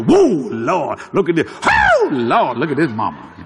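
lightly mimicked leering men, opening on a big whoa; gawking guys. Three guys gawking at a woman passing, mock amazement. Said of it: "three guys next to them. They go".

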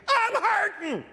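high-pitched cry of pain; high, pained outburst; wanting to scream. Retold or imitated. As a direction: wail it out as a retold scream. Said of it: "I was wanting to scream".

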